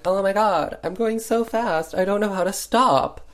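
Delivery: high-pitched pretend panic; mock yelling. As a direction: cry out as a fake runaway rider. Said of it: "she's, like, yelling".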